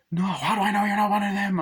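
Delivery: high, loud suspicious shout; a cornered man yelling accusations; paranoid yell. Retold or imitated. Paranoid man accuses the others of being one of them, loud high yell.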